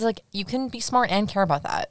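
ordinary talk, nothing marked

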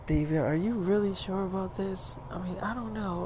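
put-on soft, doubtful girlfriend voice; hushed hesitation. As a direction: keep it quiet and hesitant. Nervous girl in dark movie theater.

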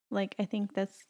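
usual speaking voice

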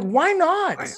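high, mock-incredulous why not; incredulous protest. Pitching chef show, told no.

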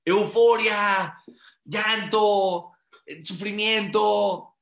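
each word drawn out slow and loud; slow emphatic list. Real, not acted. Rabbi listing Tisha B'Av feelings, slow and emphatic. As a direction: weigh every word.

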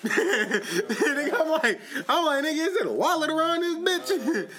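laughing. Retold or imitated. Retelling searching, laughing.

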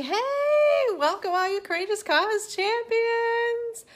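loud, high, bright hello to listeners; excited greeting. Acted. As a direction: call out the welcome. Podcast intro greeting.

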